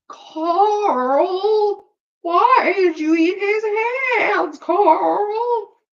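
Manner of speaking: high, loud, distorted wail; hammy high-pitched outrage; Carl meme voice. Retold or imitated. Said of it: "Yes, I do voices".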